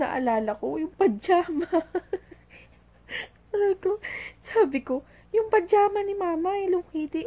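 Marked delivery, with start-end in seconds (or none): 1.7-7.3 laughing incredulous question, pitched high and loud; giggling through a disbelieving question. Real, not acted. Mother laughing at her child's swimsuit idea, high and loud. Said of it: "Tawa ko ng tawa".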